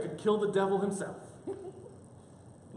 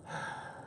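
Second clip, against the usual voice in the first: long soft exhale; acted sigh; 10 dB quieter than usual. Wife relenting after a pause, sighing. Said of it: "before responding with a".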